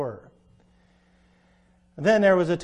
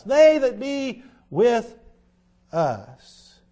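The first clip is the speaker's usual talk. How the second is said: preaching; 5 semitones higher, 4 dB louder, much slower than usual. Acted. Preacher drawls key verse.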